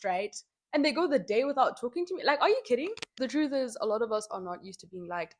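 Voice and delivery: ordinary talk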